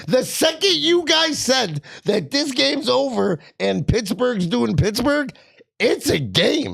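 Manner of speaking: high, drawn-out gloating retort; animated told-you-so; excited outburst. Real, not acted. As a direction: ride high and stretch it out, fired up. Excited retort as comeback unfolds.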